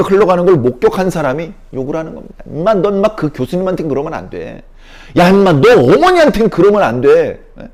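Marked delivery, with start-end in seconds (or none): 2.5-7.4 scolding rebuke. Acted. Bystander scolding ungrateful person.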